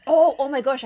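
normal talking voice

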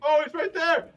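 high loud startled cry; startled shout. Real, not acted. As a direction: yell it out in alarm. Spotting big monster.

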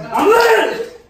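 high, loud hype yell; excited shout. Real, not acted. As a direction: hype yourself up. Pepper heat kicking in.

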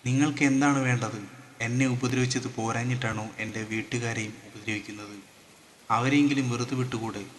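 ordinary delivery

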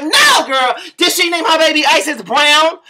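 high, loud shocked disbelief. Retold or imitated. Friend on the phone, shocked by the baby's name. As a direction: gasp it out like the news just broke.

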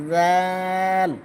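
জাল with a soft buzzing th and held vowel; tongue at the teeth, stretch জাল; soft zal. Shown as a demonstration. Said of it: "জাল নরম". (জাল নরম করে)

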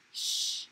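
long voiceless "sh" by itself; sh sound. Shown as a demonstration. Teacher answering what sound, isolated "sh". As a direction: hold "sh" with no voice. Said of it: "What sound?".

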